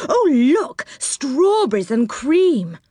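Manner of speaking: high, delighted exclamation; excited discovery. A narrator's reading. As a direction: point out a treat with delight. Character spots a delightful treat.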